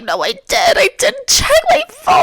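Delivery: very high hammed-up wail; acted crying. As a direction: cry out to mom in a squealing whine. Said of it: "I'm crying".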